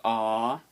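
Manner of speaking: "ah" held as one long vowel. Shown as a demonstration. Teacher showing two A's as one long "ah". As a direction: draw out "ah" without a break. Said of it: "it could be a long A".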